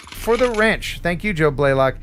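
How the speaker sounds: ordinary delivery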